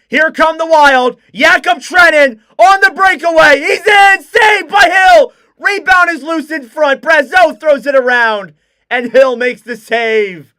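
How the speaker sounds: high, loud rush of play-by-play; excited breakaway call. Real, not acted. Commentator shouting the Wild's breakaway chance, loud. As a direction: ride the breakaway with rising urgency.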